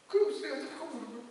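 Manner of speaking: softly, on the edge of tears; a hint of tears while retelling; choked up. Real, not acted. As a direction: let the grief catch in the throat. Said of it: "막 울면서".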